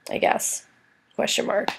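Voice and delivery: wry whispered aside; whispering. Real, not acted. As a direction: breathe it out as a doubtful joke. Wry unvoiced aside about finishing.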